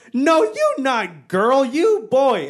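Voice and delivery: high, slow, insistent broken English; mock mother flatly contradicting, word by word; imitated mom. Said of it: "She goes".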